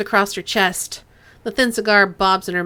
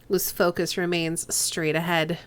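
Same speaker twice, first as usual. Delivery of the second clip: normal talking voice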